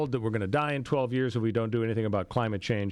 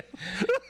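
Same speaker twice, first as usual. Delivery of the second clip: burst of laughter; 13 semitones higher than usual. Real, not acted. Laughs at the movie quote.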